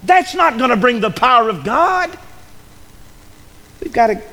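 hammered-out revival preaching; full-force preaching. Acted. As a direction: build the sermon to a fervent peak.

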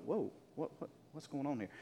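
hushed and soft, caught off guard at night; startled hushed thought. Retold or imitated. Pastor hears a prowler at two in the morning, hushed alarm.

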